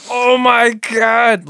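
high, slow, loud anime cry in broken English; broken-English accent. Acted. Anime character speaking English, broken-English accented yell. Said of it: "it's always someone with an accent".

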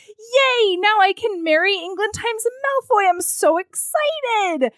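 put-on high, squealing giddy girl, dragging out the end; giddy excitement. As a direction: gush like a lovestruck girl. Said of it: "And she's like".

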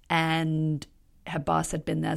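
ordinary talk

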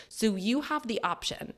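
ordinary delivery

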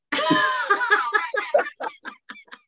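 high, drawn-out cackling; long helpless laugh. Real, not acted. Co-host admits hanging up on partner, helpless laughter. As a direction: burst out laughing and keep going. Cracking up at hang-up confession.

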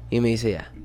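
ordinary talk

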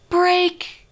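high, loud startled cry of the word; big read-aloud gasp of surprise; surprised shout. Shown as a demonstration. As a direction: burst out in alarm as the toy snaps. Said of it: "reading it in with that emotion and with that tone of this surprise".

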